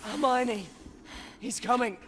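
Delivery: high, loud panicked warning; urgent shout. Acted. Harry shouting to Hermione that Voldemort is coming. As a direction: yell the warning with everything.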